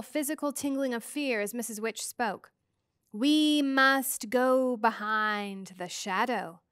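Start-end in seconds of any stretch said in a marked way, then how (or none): 3.1-6.6 slow, drawn-out eerie voice; slow otherworldly voice. Acted. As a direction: stretch each word like an ancient being. Missus Which speaks, eerie figure.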